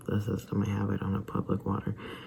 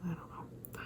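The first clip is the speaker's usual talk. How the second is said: breathy defeated whisper, higher; 7 semitones higher, 15 dB quieter than usual. Real, not acted. To herself about the allergist, a resigned whisper. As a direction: let it slip out as a tired whisper.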